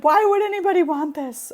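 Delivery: high, disbelieving exclamation; mock horrified question; incredulous. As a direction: squeal it in disbelief at something gross. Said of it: "I'm like".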